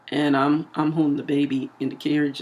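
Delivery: ordinary talk, nothing marked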